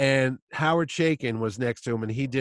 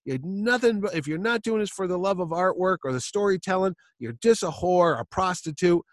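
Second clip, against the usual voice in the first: ranting Chaykin; 7 semitones higher than usual. Retold or imitated. Chaykin ripping into the fan.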